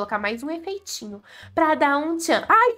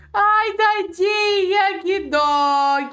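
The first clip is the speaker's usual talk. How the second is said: 1 mock pity; 6 semitones higher, much slower than usual.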